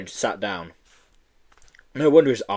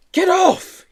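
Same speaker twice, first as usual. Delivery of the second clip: very high loud squeal; 6 dB louder than usual. Acted. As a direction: squeal it in panic. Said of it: "he squealed".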